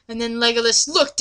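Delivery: usual speaking voice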